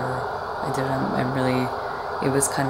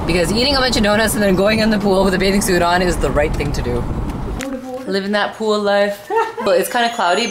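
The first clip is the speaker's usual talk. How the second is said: excited; 4 semitones higher, 7 dB louder than usual. Real, not acted. Excited vlog greeting, loud throughout.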